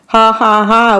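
usual speaking voice

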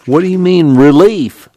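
indignant challenge. Acted. Little fella challenges the deputy.

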